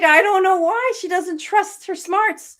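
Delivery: high, loud imitation of a sarcastic grandmother; lightly acted wry bafflement; mock sarcasm.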